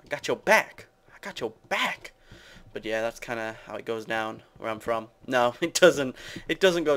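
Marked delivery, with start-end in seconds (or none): 0.0-2.1 higher, louder hype-man voice; mock bro enthusiasm, pitched up; hyped-up bros. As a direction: imitate pals cheering each other on. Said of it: "people are like".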